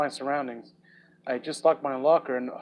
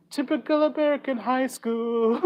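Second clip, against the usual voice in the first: sketch impression; 8 semitones higher than usual. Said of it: "the Kim Jong-un sketch".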